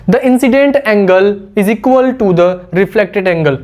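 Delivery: each word laid out very slowly; slow dictation. Shown as a demonstration. Teacher dictating the law to students, very slow. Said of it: "dictating the law slowly".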